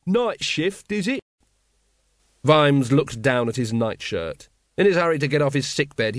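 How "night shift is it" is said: high, bright cheeky jibe; a cheeky shout from the back; higher cheeky heckle; cheerful heckler. A narrator's reading. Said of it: "the cheerful voice".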